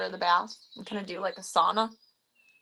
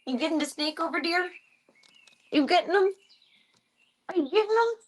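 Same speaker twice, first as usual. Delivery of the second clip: excited pet talk; 10 semitones higher, 4 dB louder than usual. Real, not acted. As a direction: tease the pet in a high, excited coo. Cheering on pet with toy snake.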